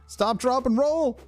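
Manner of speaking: near-squeal chant. Real, not acted. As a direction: rise in panic on repeat. Fire still not out.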